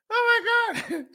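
very high, squeaky oh my God; helpless high-pitched amusement; laughing disbelief. Real, not acted. Overcome by laughter.

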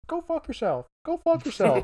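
high squawky parrot voice. Retold or imitated. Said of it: "they had a parrot".

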